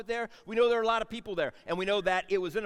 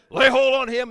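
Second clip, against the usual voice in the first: full-force, high royal bellow; commanding shout; 6 semitones higher, 14 dB louder than usual. Acted. Re-enacts king's arrest order.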